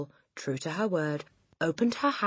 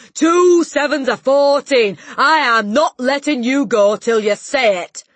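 slow, high, furious bellow; bellowing Trunchbull; 9 semitones higher, 16 dB louder than usual. A narrator's reading. Headmistress drilling the dangling boy, furious bellow. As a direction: roar it out very slowly. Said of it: "Miss Trunchbull bellowed".

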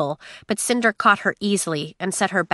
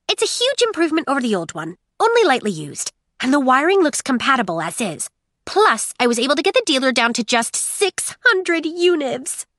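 eager android voice; 6 semitones higher than usual. Acted. Android Aiko reports excitedly.

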